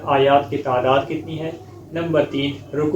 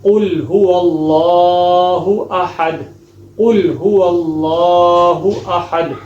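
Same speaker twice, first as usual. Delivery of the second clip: proper tajweed chant; correct recitation; 4 dB louder, much slower than usual. Shown as a demonstration. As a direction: recite with heavy qaf and heavy ha, drawn out. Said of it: "اب صحیح طریقے سے کیسے پڑھیں گے".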